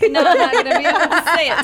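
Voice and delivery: fast, loud, laughing protest. Real, not acted. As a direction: laughs through the refusal. Laughing, refusing to say it.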